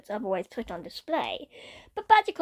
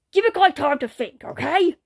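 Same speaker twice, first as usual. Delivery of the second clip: loud, higher rapid-fire outburst; exasperated exclaim; 5 semitones higher, 6 dB louder than usual. Acted. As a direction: blurt it out fast and fed up. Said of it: "he exclaimed in rapid fire".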